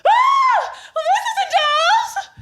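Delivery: high loud shriek of shock; shocked cry. Acted. Mother finding the baby isn't hers, high and shrieking. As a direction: recoil at the wrong baby.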